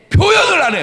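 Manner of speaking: loud and high; shouted point. Real, not acted. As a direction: hammer home the point. Emphatic point. (크게 높여 강조)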